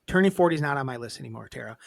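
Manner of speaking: ordinary delivery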